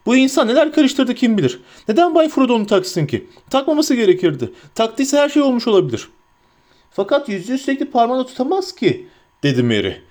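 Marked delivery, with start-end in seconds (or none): 0.0-6.1 high, worked-up hobbit voice; agitated hobbit. A narrator's reading. 6.9-9.1 high-pitched, protesting hobbit voice; Merry. A narrator's reading.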